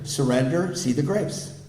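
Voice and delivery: ordinary talk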